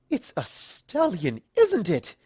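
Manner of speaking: rising to a high, loud squeal. Acted. Rarity guessing Rainbow has a stallion, squealing. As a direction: squeal with delight at the gossip. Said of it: "Rarity squealed".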